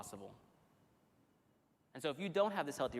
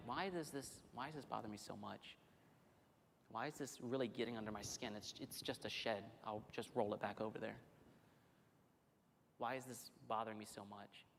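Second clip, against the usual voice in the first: quiet self-questioning; 4 semitones lower, 8 dB quieter than usual. Acted. Inner questioning, hushed.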